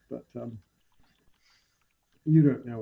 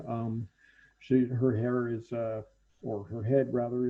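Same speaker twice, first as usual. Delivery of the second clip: ordinary talk, nothing marked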